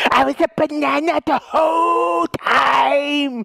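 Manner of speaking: high, drawn-out wail; mock reveal. Joker reveals he was a banana, high wail. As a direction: stretch the big reveal into a shout.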